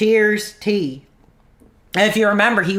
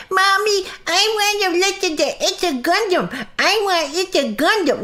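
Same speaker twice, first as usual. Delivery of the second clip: high, whining mock toddler; whiny baby voice; 9 semitones higher than usual. Mocking his viewers as babies wanting their rattle, high whiny voice. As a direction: sneer at them as crying babies begging.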